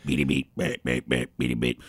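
very low, robotic beeping; deep beep beep sounds; low Morse beeps. Acted. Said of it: "that's just Morse code".

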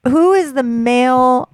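a hint of a laughing dad. Imitating dad asking Alexa.

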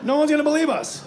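high, loud cry of amazement; astonished shout. Acted. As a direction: shout it like a stunned driver. Imagined driver sees Clint Eastwood.

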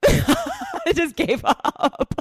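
high, drawn-out laughing words. Real, not acted. Telling co-host about being tackled, laughing through it. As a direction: laugh through a helpless admission.

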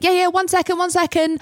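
very high, strained fake-casual reply; squeaky stalling. To the staff at the door, in a strained high squeak. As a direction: squeak it out, pretending all is fine.

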